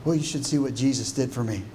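low, soft, understated remark; quiet voice. Acted. Preacher mocking a timid testimony, quiet low voice. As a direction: keep it small and hushed, as if sitting back. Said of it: "with a quiet voice and say".

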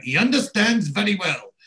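high-pitched, indignant mock nurse; imitated raging nurse. Nurse snaps at the visitors about her patient, imitated rage. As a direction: act out an exasperated nurse, pitched well up. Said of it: "the nurse has got the raging up".

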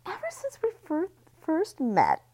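high, loud gossip voice; Missy teasing the party with her story; sweetly malicious. Acted.

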